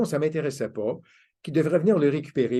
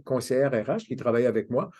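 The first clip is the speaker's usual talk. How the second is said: normal talking voice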